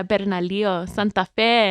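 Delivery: Bernalillo and Santa Fe said loud with Spanish sounds; Spanish accent. Shown as a demonstration. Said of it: "your Spanish accent really".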